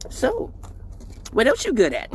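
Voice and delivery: high mocking mom scolding; high hammed-up mom taunt. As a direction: tease like his mom catching them leaving. Mom voiced teasing.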